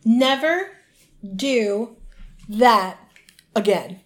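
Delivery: Never, do, that, again each set apart by a pause; pauses between words. Retold or imitated. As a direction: punch out "Never... do... that... again." word by word. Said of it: "every time that I pause".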